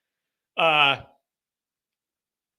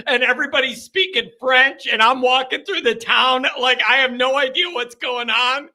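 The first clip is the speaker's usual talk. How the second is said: normal talking voice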